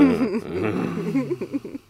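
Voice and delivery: high, sly fox cackle. Acted. Reineke lacht verschlagen. (verschlagenes hohes Kichern)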